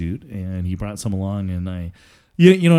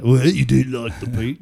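half-attempted Scottish accent; 3 semitones higher, 5 dB louder than usual. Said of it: "I'm not even gonna attempt a horrible accent".

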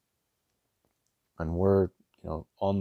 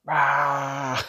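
imitated banshee shriek, higher and louder; banshee scream; 7 semitones higher, 6 dB louder than usual. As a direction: let out a brief mock shriek. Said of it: "she just banshee screamed".

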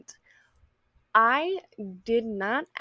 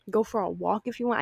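ordinary talk, nothing marked

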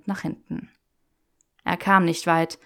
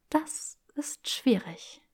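slow, breathy whispered tease; 7 semitones higher, 6 dB quieter, much slower than usual. Acted. Granger teasing Draco close up, slow whisper. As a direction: whisper it slowly.

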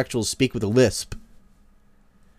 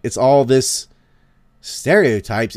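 normal talking voice

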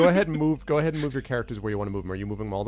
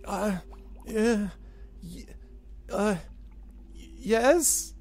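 confused goblin hesitation; 9 semitones higher, much slower than usual. Acted. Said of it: "contemplating advanced nuclear physics".

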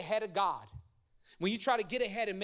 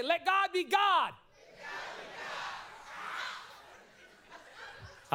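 best preacher voice; 13 semitones higher, slower than usual. Shown as a demonstration. Pastor leading congregation in preacher voice, hammed-up shout. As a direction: belt it out like an old-school preacher. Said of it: "I need your best preacher voice".

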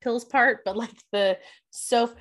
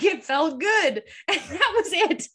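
laughing; 7 semitones higher, 3 dB louder, slower than usual. Retold or imitated. Quoting Kate, laughing through it.

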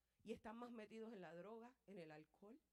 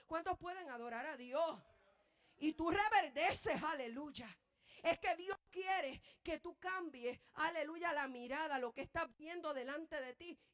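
loud and higher, shouting triumph; fiery sermon climax; full-force preaching; 5 semitones higher, 11 dB louder than usual. Acted. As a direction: preach at full force, slowing on key phrases.

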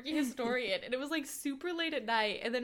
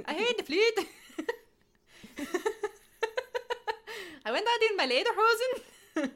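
giggling joke; laughing through it; 9 semitones higher, 4 dB louder than usual. Real, not acted.

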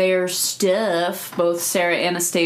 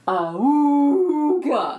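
high, slow honking awooga; ahooga horn; 7 semitones higher, much slower than usual. Pretend. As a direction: honk it like an old car horn. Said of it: "The awooga".

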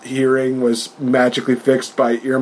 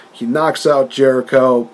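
ordinary delivery

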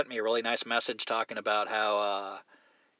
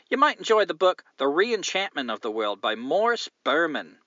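put-on Australian accent; 4 semitones higher than usual. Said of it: "if I just do an English accent, it comes out Australian anyway".

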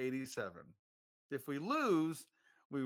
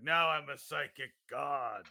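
dark lord; 3 semitones lower than usual. Retold or imitated. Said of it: "Now he's like".

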